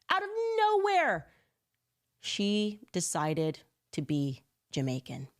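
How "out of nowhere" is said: jumped way up, incredulous; milk the disbelief, go high; soaring mock amazement. Real, not acted. Mocking Kamala's new accent, high incredulous repeat. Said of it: "dramatic repeat".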